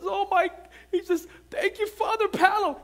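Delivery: high, choked-up gratitude; overwhelmed dentist. Retold or imitated. Dentist overwhelmed, thanking the pastor, high and choked up. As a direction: voice jumps up, moved to tears.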